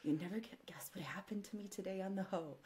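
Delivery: soft, lightly voiced royal confiding to family; the Queen. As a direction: imitate a royal sharing amusing gossip, hushed. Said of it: "'Cause she was like".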